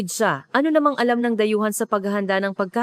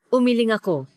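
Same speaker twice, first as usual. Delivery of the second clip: usual speaking voice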